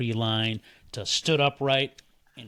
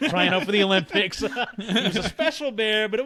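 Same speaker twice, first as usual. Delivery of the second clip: laughing; 8 semitones higher than usual. Real, not acted. Joking about fast bear.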